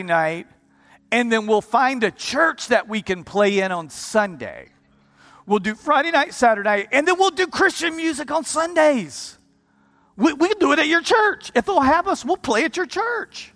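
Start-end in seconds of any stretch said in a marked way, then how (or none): 5.4-13.5 eager pitch. Retold or imitated. Friend eagerly pitches compromise.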